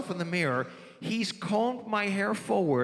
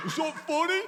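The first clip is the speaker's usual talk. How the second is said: mock incredulous cop, pitched way up; a raised, needling cop question; cop's challenge; 10 semitones higher than usual. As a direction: imitate the cop going high and sharp. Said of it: "he goes".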